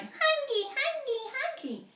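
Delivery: put-on very high baby voice, falling each time; baby whine. Finn disguised as a baby in the cradle, very high whining. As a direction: whine like a hungry infant. Grown man playing baby.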